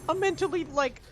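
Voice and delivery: pissed rant. Real, not acted. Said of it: "I'm pissed".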